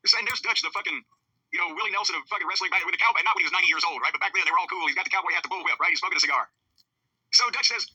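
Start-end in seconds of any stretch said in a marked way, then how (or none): none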